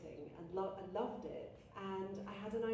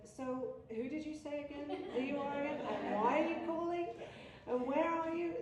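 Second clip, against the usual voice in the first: suspicious lady; 7 semitones higher than usual. Retold or imitated. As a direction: grill the caller like you've grown suspicious. Suspicious school board lady questioning.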